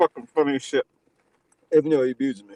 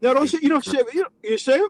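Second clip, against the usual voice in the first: high, alarmed pleading; 9 semitones higher than usual. Acted. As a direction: voice jumps up in alarm. Panicked stopping him shaving.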